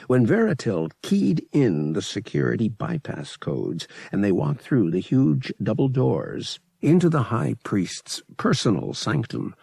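plain reading voice

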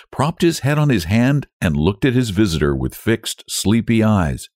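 ordinary delivery, nothing marked

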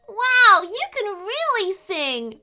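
high loud wow; impressed girl. A narrator's reading. Girl praising the car's singing, excited. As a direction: gush with admiration.

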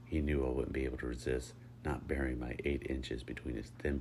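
plain reading voice

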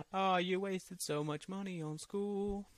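mock pity. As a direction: draw it out in teasing fake sympathy. Mocking the film-school critic.

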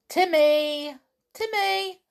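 loud high call for the dog. A narrator's reading. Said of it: "called George again".

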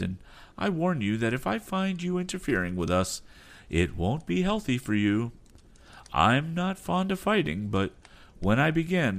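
high, firm threatening voice; captain's stern warning. Acted. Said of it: "diffident manner all gone".

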